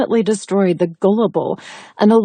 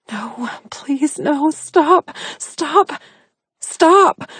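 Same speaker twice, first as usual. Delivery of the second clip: from a whisper up to a high cry; beg, rising higher with every stop; terrified pleading; 7 semitones higher, much slower than usual. Acted. Captive begging her attacker, whisper building to high cry.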